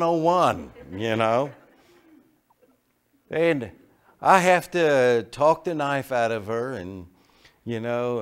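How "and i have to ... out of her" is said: ordinary delivery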